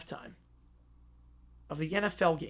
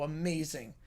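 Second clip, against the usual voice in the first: ordinary delivery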